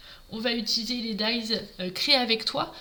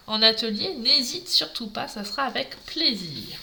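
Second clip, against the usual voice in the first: normal talking voice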